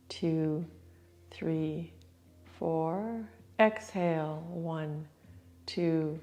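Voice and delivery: soft, calm breath-count cues; guided breathing count. Acted. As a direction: count the breath slowly and gently. Counts breathing for yoga class.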